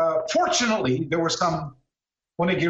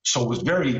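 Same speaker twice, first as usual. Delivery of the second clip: ordinary talk, nothing marked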